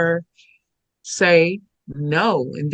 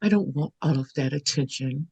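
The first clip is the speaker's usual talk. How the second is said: softer, hushed refusal; very quiet; 6 dB quieter than usual. Retold or imitated. Imitating the teacher's very quiet way of speaking. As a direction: say it hushed and gently. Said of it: "Very quiet".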